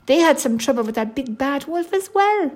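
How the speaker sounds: high-pitched eager bear cub; little bear. Acted. Baby bear invites his new friend, high little voice. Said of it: "he said".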